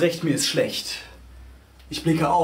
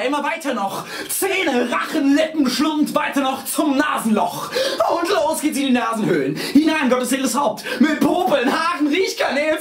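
frenzied, disgusted recital; 11 semitones higher, 4 dB louder than usual. A narrator's reading. Narrator following riders into God's nostrils, frenzied disgust.

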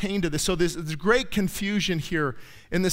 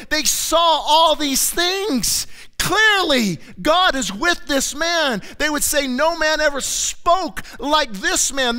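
higher and louder, the preacher in full flight; preaching at full force; 5 semitones higher, 4 dB louder than usual. Acted. Preacher building to a climax before his congregation, full force. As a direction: drive the miracles home to the congregation.

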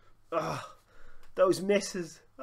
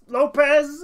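loud, high, drawn-out call of the name; excited shout; 10 semitones higher, 12 dB louder than usual. Real, not acted. Commentating his own game, shouting as Lopez shoots. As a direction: yell the striker's name as he shoots. Chance falls to striker.

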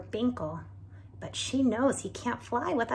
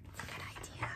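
whisper; 11 dB quieter, much faster than usual. Shown as a demonstration. Whispered aside to listeners.

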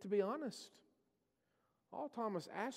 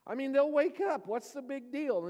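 higher, louder mock protest; exasperated disciples; 6 semitones higher, 4 dB louder than usual. Disciples protesting to Jesus about going to Bethany, higher exasperated scoffing. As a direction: scoff at the risky trip. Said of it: "the disciples are like".

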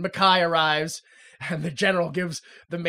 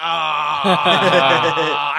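mock startled gasp; 4 semitones lower, 7 dB louder than usual. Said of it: "And the major's like".

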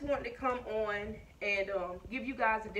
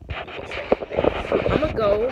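slow, voiceless and louder, forced out with effort; straining to get up; 8 dB louder, much slower than usual. Real, not acted. Mother in a walking boot heaving herself up, slow voiceless strain. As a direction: push the words out as you heave yourself up.

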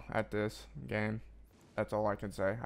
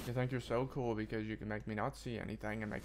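ordinary talk, nothing marked

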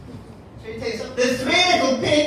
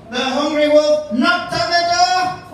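plain reading voice

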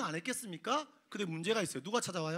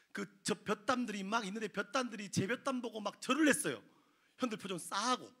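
ordinary delivery

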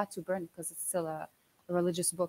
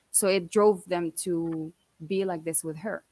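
usual speaking voice

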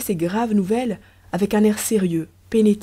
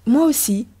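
higher-pitched, formal public reply; polite small talk; 5 semitones higher, 4 dB louder than usual. A narrator's reading. Woman answers her secret lover in the street, polite small talk. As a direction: answer like a mere acquaintance. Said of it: "quelques phrases d'une absolue banalité".

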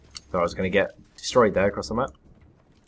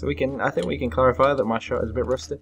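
ordinary delivery

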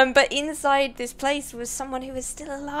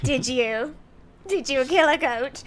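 mock Mrs Claus; 3 semitones higher, 4 dB louder than usual. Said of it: "She's like".

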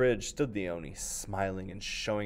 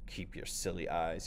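low, soft, slow grumble; low rumble; 4 semitones lower, 5 dB quieter, slower than usual. Acted. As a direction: rumble it out, defeated. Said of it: "he rumbled".

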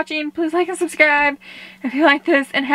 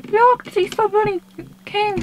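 normal talking voice

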